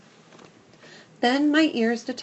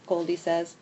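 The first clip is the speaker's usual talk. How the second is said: ordinary delivery, nothing marked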